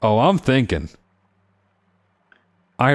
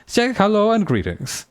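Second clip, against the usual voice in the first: high, flowery greeting; fancy voice; 3 semitones higher than usual. Acted. Merchant prince introduces himself grandly, high and fancy. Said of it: "a fancy voice".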